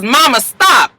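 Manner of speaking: acted exasperated daughter; firm command. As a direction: cut in sharply to halt her. Daughter halting panicking mother.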